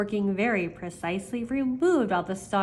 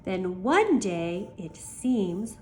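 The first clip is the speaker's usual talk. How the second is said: usual speaking voice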